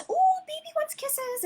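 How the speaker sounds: very high, drawn out slowly; sing-song baby talk. Retold or imitated. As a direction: coo the invitation sweetly. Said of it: "I sound ridiculous when I'm doing sessions".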